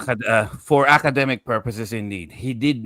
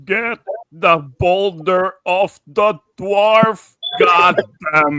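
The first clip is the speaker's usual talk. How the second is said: slow, higher delirious cry; hallucinating; 6 semitones higher, slower than usual. Acted. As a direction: drag out each word in delirium. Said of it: "throes of a hallucinatory episode".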